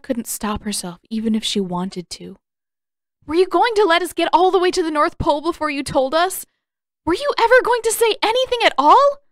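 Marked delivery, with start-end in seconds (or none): 3.3-9.2 fast, high, loud raging questions; furious tirade. Acted. Katara rages at Aang, fast and loud. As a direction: keep hammering him, unable to stop.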